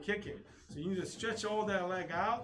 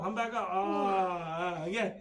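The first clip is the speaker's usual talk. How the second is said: usual speaking voice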